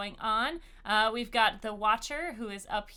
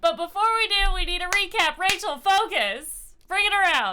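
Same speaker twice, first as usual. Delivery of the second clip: lively rally; 8 semitones higher, 9 dB louder than usual. Real, not acted. DM rallies table for recap.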